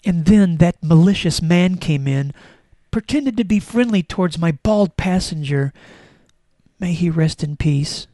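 mock-tragic, heavily emphasised read-aloud; melodramatic diary reading. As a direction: lay on the sorrow like a tragic final entry. Reads final diary entry, mock tragic.

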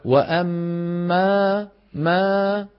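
thin light alif in ما; slow demo. Shown as a demonstration. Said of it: "طلعت الألف مرققة".

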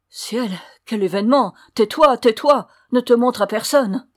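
loud, high, agitated commands; horrified urgency. A narrator's reading. Mother horrified by the toads, urgently hushing her daughter. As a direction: silence her in a panic.

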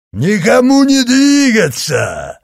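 loud, slow roaring command; growl. A narrator's reading. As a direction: bellow it like a giant. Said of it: "прорычал Орестес".

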